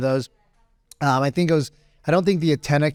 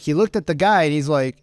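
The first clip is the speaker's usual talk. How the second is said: normal talking voice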